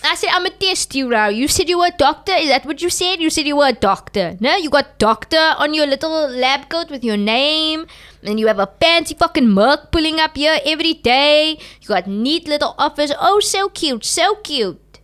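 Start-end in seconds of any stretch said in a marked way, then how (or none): 0.3-13.1 loud, higher challenging taunt; sarcastic interrogation, pitched up and louder; mock confrontational. Retold confrontation with therapist. 13.2-15.0 mocking. Mocking the office.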